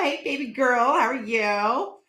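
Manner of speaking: slow, drawn-out sweet hello; weird greeting. Shown as a demonstration. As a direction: stretch the hello out slowly. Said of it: "Hey, that sounded weird".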